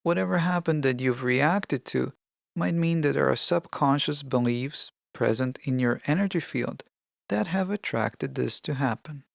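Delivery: usual speaking voice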